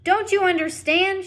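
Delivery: high, loud, exasperated shout. Acted. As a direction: shout it at her in frustration. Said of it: "shouted Lester".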